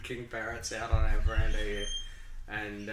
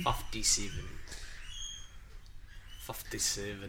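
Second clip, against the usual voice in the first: put-on New Zealand accent; 3 semitones lower, 8 dB quieter than usual. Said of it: "don't pronounce their vowels in New Zealand".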